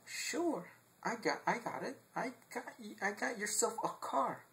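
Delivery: high, hushed salesman pitch; car dealer voice. Retold or imitated. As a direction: play the eager used-car dealer. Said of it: "this guy told me".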